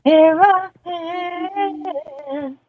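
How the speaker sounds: high, long drawn-out singsong; playful head voice. Pretend. Co-host playing the voices in her friend's head, high singsong. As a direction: pipe up high as an imaginary voice.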